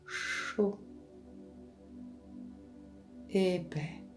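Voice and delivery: soft words released on an out-breath; on the exhale. Shown as a demonstration. Guide showing words to repeat mentally, softly on the exhale. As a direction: breathe each word out softly, leaving a long pause. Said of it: "sur l'expiration, vous pouvez prononcer".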